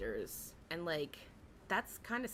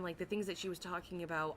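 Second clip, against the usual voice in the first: normal talking voice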